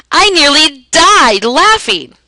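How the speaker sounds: high, loud, drawn-out overstatement; dramatic exaggeration. Shown as a demonstration. Teacher giving a hyperbole example, dramatically exaggerated. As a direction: stretch and hammer DIED LAUGHING for effect.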